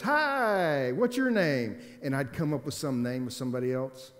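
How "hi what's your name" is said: higher, louder bright greeting at the door; mother pretending not to know her son; mom playing along. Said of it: "and she goes".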